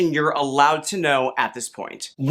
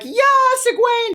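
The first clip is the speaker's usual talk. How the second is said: high, louder cheer of her name; crowd cheer; 4 dB louder, slower than usual. Retold or imitated. Villagers cheering as Egwene enters the inn. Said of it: "Everyone's like".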